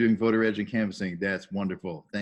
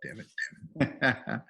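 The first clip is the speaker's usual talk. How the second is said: ordinary talk, nothing marked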